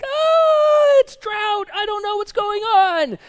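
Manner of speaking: loud, very high panicked shriek; hammed-up high-pitched freak-out; panicked scream. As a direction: scream it like a branch in a frenzy. Acts out frantic branch being like.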